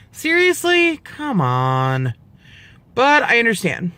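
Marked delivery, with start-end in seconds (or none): 0.1-2.1 incredulous. Real, not acted. Incredulous at hospital insistence.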